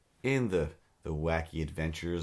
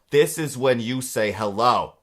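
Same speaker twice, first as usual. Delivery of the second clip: loud, slow yell; 4 semitones higher, 9 dB louder than usual. Retold or imitated. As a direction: yell it down the phone, slowly. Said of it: "And then I yell".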